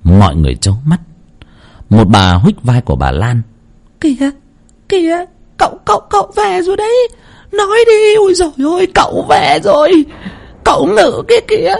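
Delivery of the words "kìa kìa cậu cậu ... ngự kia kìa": much higher, fast, shouting with excitement; excited spirit sighting. Acted. Woman nudging her friend, excitedly shouting the spirit came. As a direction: squeal that the spirit has arrived.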